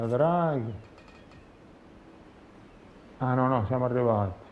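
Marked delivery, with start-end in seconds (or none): none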